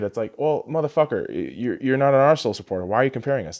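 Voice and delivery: ordinary talk, nothing marked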